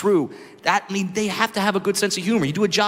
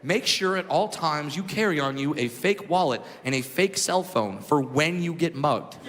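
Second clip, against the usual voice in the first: ordinary talk, nothing marked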